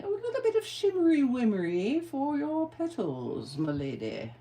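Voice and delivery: hammed-up British accent, pitched high then dropping; bad British accent. Crafter offering shimmery wimmery to a lady, bad British accent. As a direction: put on a really bad, playful British accent. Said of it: "I just used that really bad British accent".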